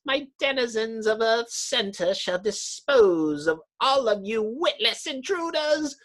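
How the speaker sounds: a hint of madness in a gloating villain; mad villain. Mole man boasting to the heroes, touch of madness. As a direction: gloat like a mad underground king. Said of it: "A note of madness creeps into the mole's voice".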